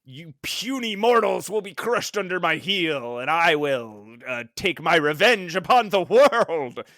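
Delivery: megalomaniac rant; supervillain voice. Retold or imitated. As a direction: gloat like a supervillain. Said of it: "speaking like a supervillain".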